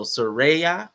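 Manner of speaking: Soraya drawn out slowly, rising at the end; testing the stress. Shown as a demonstration. Host trying out a name, Soraya slow and questioning. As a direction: stretch Soraya as so-RAY-ah, tune climbing. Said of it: "put the emphasis on the right syllable".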